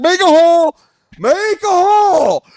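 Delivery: re-enacted barging call; crowd-clearing shout. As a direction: holler your way through a crowd.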